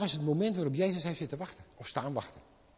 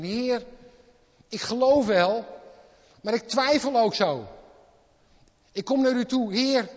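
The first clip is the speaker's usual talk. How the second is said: loud, higher, earnest pleading; loud plea; 6 semitones higher, 7 dB louder than usual. Acted. Father confessing doubt to Jesus, loud earnest pleading. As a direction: pour out your doubt to the Lord.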